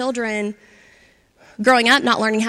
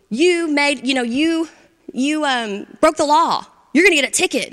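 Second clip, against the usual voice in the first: mild mock yell; 5 semitones higher than usual. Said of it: "kind of yelling at you".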